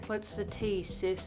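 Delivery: ordinary talk, nothing marked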